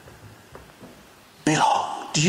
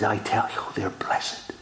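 hushed; 6 semitones lower, 5 dB quieter than usual. Retold or imitated. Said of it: "He says".

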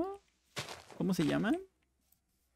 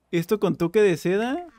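normal talking voice

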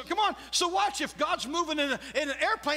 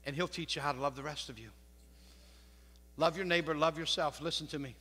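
lower, softer preaching, close and personal; soft intimate preaching; 9 semitones lower, 5 dB quieter than usual. Acted. Preacher softly urging the congregation to love themselves, hushed intimate preaching. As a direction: lean in and speak gently to the congregation.

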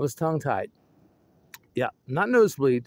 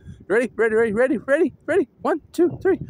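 excited countdown; 11 semitones higher, 4 dB louder, faster than usual. Real, not acted. Counting down to scare somebody, excited and rising. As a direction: pitch climbing, rapid excited readies.

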